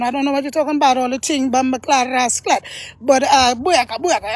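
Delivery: playful put-on Jamaican accent. Shown as a demonstration. Showing off accents to viewers, in mock Jamaican. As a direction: talk like a Jamaican man. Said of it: "I talk like a Jamaican man".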